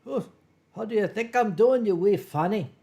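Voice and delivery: imitating a grumbling Glasgow Scot; mock Scottish grousing, thick Glaswegian accent. Scottish friend answering how he's doing, imitated Glaswegian grumble. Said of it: "He's from Glasgow".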